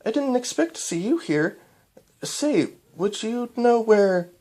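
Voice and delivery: put-on high young princess voice; young mare puzzled, slowing at the end; Cadance voice.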